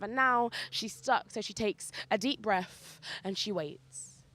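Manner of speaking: rhythmic spoken-word narration with measured pauses; poetry-slam storytelling; spoken-word poem. Acted. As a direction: perform it as a live poem, driving through the long lines and landing the endings.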